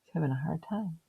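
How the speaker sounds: plain reading voice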